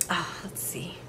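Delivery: a soft unvoiced whisper while searching; whispering to herself. Real, not acted. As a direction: breathe the words to yourself. Searching screen, muttering to herself.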